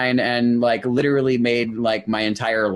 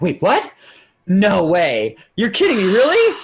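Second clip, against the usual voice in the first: high, louder disbelieving rush; higher, louder stunned disbelief; astonished; 8 semitones higher, 5 dB louder than usual. Retold or imitated. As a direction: react like you can't believe it. Astonished by wristband offer.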